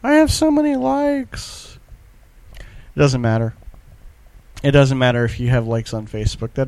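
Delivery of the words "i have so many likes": high, slow, smug boast; bragging voice. Retold or imitated. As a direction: act like a smug show-off. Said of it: "People are like".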